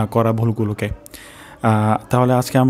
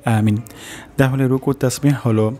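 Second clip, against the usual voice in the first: ordinary delivery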